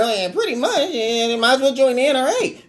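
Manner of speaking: mock whiny pundit again; a hint of a whine. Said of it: "he got the whiny voice".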